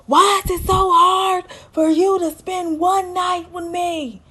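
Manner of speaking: high-pitched mock frustrated yell; screaming. Recapper voices Dante pleading outside with Nicole, screaming. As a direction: imitate a frustrated man wailing his complaint. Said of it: "Dante is outside screaming".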